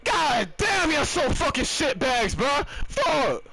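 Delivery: high-pitched raging rant; angry gamer tirade, pitched high; furious yelling. Real, not acted. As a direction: scream abuse at the bad passer. Still raging at teammates' passes.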